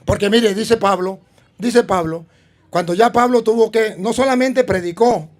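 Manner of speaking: preaching. Acted. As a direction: rouse the congregation to evangelize. Preaching conclusion.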